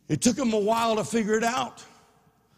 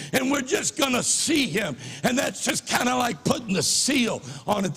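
worked up; 5 semitones higher than usual. Real, not acted. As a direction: preach it with rising fervor. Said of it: "excuse me if I get a little worked up".

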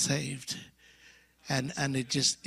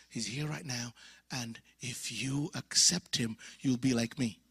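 hushed; 6 semitones lower, 6 dB quieter than usual. Retold or imitated. Solemn hushed telling to friends.

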